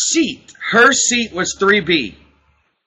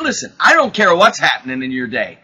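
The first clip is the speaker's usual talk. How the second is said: ordinary delivery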